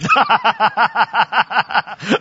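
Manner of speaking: high burst of real laughter. Real, not acted. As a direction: laugh at yourself out loud. Laughing at his own grumpiness.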